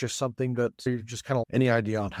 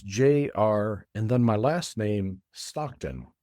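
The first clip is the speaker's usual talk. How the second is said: slow, spaced-out dictation; slow spelling-out; slower than usual. Real, not acted. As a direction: spell it out slowly for a listener. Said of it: "spell those things out as slowly as I can".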